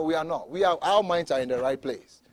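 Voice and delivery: normal talking voice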